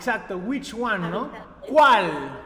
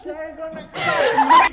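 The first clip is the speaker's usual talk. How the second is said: very high, loud hammed-up wail; playful shriek of a fugitive; mock panic; 8 dB louder than usual. Teacher pretending to flee the police, mock panic.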